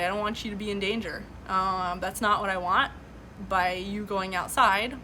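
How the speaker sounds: usual speaking voice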